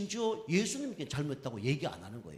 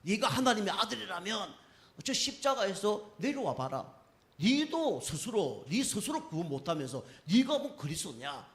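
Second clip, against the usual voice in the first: higher-pitched imitation of jeering; taunting challenge in a raised pitch; mocking; 6 semitones higher than usual. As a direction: act out scoffers daring him to prove it. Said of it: "그만큼 조롱을 당했죠".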